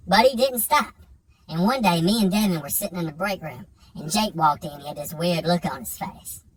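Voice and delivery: ordinary talk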